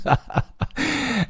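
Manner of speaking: sheepish high-pitched giggle. Real, not acted. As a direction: giggle at own accent. Laughing at own German accent.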